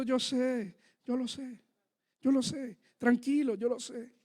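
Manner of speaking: soft, low soothing repetition; hushed and calm, as God comforting a worried child; God's quiet reassurance. Retold or imitated. God answering the worried man, softly reassuring. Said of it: "el Señor me está diciendo".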